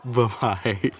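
laughing goodbye; giggling bye-bye through laughter. Real, not acted. Breaks into laughter.

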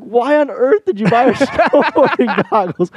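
high, laughing mock question; laughing disbelief. Teasing her dad about the gift, laughing and high.

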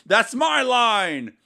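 high, loud and slow, a hammed-up tough-guy line; milk the catchphrase like a movie star; mock dramatic comeback. Anderson Silva retorting to his attacker, hammed-up and slow.

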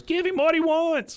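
Rusty's voice. Retold or imitated. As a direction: switch into the fake alter ego. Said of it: "he goes into Rusty's voice".

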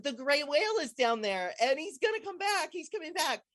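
high, eager retold exclamation; bubbling over about the whale's return; excited. Telling her friend the whale is coming, in high excitement. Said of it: "I'm so excited".

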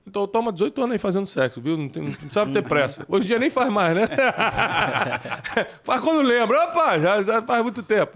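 conta rindo, bem mais alto e agudo. Real, not acted. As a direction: brincadeira entre risadas. Brincadeira com risada. (rindo alto da própria piada)

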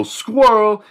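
shouted game call. Retold or imitated. Classmate yelling Squirrel before a sack tap. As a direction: yell it out before the hit. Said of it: "they'd go".

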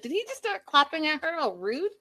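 mock outrage at the rude man; indignant. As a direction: scold him like an outraged friend. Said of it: "I was like".

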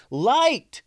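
sudden high shouted call; yell. A narrator's reading. Ninja yelling to the others for light, loud shout. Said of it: "heard one of the ninjas yell".

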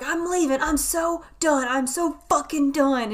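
high, loud mock rant; imitated screaming. Neighbor husband storming out on wife, mock screaming. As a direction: act out a fed-up husband yelling. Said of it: "He was screaming".

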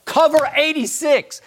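high, loud, drawn-out call; mock sideline call. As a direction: call out the coverage slowly and loudly. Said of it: "I just say".

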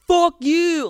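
loud, high drawn-out jeer; mock teenage yell. Podcaster imitating nineteen-year-old commenters, mock yell. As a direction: imitate an obnoxious online heckler yelling. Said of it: "nineteen-year-olds being like".

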